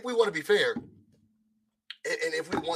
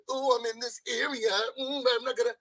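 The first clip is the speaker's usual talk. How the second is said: high, sing-song mock quote; mocking imitation; 7 semitones higher than usual. Complaining how he was communicated about, high mocking imitation. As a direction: mimic a taunting talker in a raised pitch. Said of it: "That's how I was communicated about".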